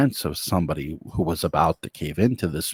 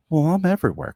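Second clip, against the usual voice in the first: a higher, smug taunt; taunting devil; 11 semitones higher, 4 dB louder, faster than usual. Acted. Devil taunting Quentin, smug and higher. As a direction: lord it over him.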